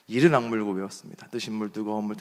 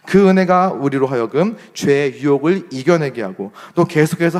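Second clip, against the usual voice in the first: louder, earnest sermon delivery; preaching; 6 dB louder than usual. Acted. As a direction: press the message home to the congregation. Pastor preaches to congregation.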